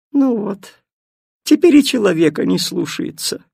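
high, loud scornful snort; snooty bored cat. Acted. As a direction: sniff at the disobedient dog. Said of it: "фыркнула кошка".

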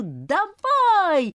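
high loud crowd yell; fans yelling. Acted. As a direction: roar it from the stands. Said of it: "заорали болельщицы Апхила".

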